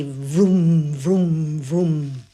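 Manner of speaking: mouth-made engine roar, falling away; imitated plane engines. Old woman mimicking German planes overhead, engine roar. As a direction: mimic fighter planes swooping past.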